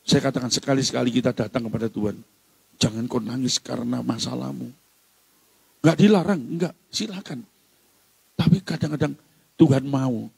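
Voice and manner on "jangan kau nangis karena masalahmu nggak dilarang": ordinary talk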